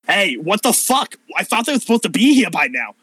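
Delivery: high, fast, anxious voice; worried NPC. Acted. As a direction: fret that help is late.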